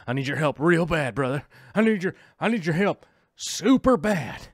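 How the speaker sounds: hammed-up begging a buddy for help; mock desperate plea. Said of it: "I'd be like".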